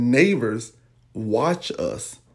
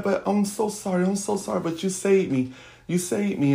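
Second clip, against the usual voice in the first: higher-pitched, mildly emotional apology; retold tearful apology; 7 semitones higher than usual. Mother thanking child after rescue, retold tearful apology. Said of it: "we was just sitting there crying".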